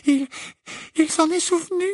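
very high, thin-voiced dismay; frightened stammer. Hermione realising the giant remembered her, frightened stammer. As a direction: stumble on the first word, fearful. Hermione, terrified, clutches Harry.

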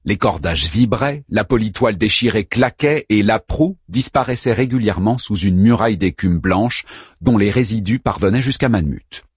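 usual speaking voice

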